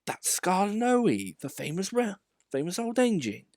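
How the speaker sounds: put-on awed young engine. A narrator's reading. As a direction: introduce him with awe. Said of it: "said Peter Sam".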